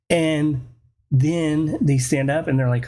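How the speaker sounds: ordinary delivery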